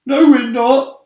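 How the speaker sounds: high, loud, squeaky denial; panicked retort. A narrator's reading. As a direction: snap back in fear. Characters bicker in panic.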